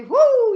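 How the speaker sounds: very high, loud drawn-out whoop; excited whoop. Acted. Reciter on Jim getting jabbed by the pin, high excited whoop. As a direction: let out a big storytelling holler. Hat pin jab starts a circus.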